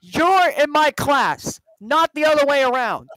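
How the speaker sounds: hammed-up movie teacher scolding; stern teacher impression. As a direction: lay down the law, building at the end. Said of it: "did a better job than George did".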